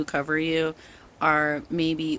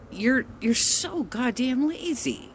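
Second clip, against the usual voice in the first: higher, drawn-out exasperated outburst; 7 semitones higher, slower than usual. Real, not acted. Creator scolding the other channel, exasperated and pitched up. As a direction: let the frustration boil over slowly.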